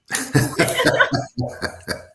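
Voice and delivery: loud, breathy laughing fit; hearty laughter. Real, not acted. Host wrapping up her pregnancy story, loud laughter.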